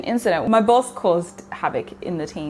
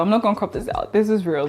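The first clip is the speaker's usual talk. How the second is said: normal talking voice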